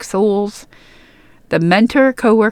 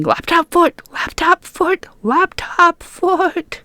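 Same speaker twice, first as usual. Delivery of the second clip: high-pitched imitation of screaming, volume held back; mock scream; 11 semitones higher, slower than usual. Daughter calling to her mom in the bathroom, high mock scream. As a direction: squeal it out in a put-on high voice, stretching the last one. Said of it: "I just started screaming".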